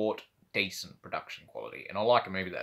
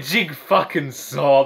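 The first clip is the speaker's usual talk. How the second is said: slow, louder drawn-out imitation; mock movie line; 4 semitones higher, 9 dB louder, much slower than usual. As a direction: ham up the actress's line. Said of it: "but she said it like that".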